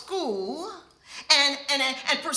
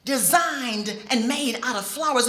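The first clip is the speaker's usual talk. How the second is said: usual speaking voice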